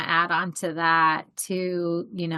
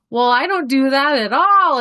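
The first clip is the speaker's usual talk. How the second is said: dismissive past self; 6 semitones higher, 8 dB louder than usual. Retold or imitated. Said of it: "my past self ... being like".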